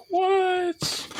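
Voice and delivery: sudden very high startled squeak. Real, not acted. Installing the grinder, startled high squeak. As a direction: yelp it in surprise.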